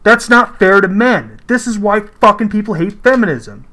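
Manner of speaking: ordinary talk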